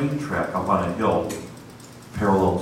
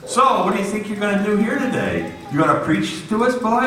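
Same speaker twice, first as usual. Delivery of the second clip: much higher mocking sneer rising on boy; taunting worker; 11 semitones higher than usual. Worker mocking the would-be preacher, sneering.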